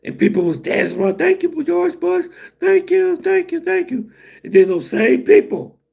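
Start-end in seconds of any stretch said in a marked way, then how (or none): 1.2-4.1 high, slow imitating a cheering crowd; grateful crowd imitated. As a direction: mimic grateful locals, pitched way up. Iraqi crowd thanking Bush.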